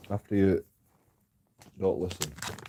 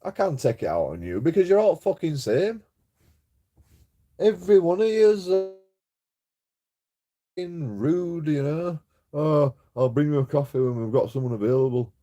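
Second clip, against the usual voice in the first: higher, louder angry yell at the officers; angry retort; 7 semitones higher, 5 dB louder than usual. Retold or imitated. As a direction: shout it back at them, fed up. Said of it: "And I'm like".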